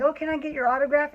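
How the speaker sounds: high, quick, eager fan voice asking for an autograph; excited fan. Retold or imitated. Kids crowding the limo beg the storyteller for an autograph, high and eager. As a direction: squeal it like a starstruck kid.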